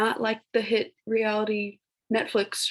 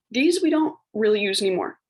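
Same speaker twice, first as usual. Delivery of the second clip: ordinary talk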